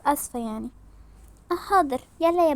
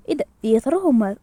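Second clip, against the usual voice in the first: usual speaking voice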